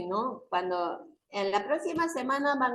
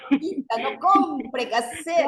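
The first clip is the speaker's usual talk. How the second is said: louder, higher and slower seller's cry; market vendor call; 5 semitones higher, 6 dB louder than usual. Pretend. Teacher playing a watermelon seller calls to a customer, higher and louder. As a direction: hawk the fruit to the customer. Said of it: "yo voy a vender mis sandías".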